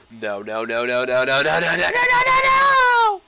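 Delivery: frantic no's rising to a high shriek; terrified gibbering. Real, not acted. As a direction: panic building into a scream. Dropping again, panicking.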